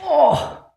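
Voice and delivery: high strained cry of pain. Real, not acted. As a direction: cry out from a cramp. Cramping after the set.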